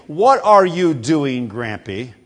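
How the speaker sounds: slow, deliberate full question; a hint of a patient grown-up asking; slow careful question. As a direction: spell out each word of the proper question. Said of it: "rather than saying".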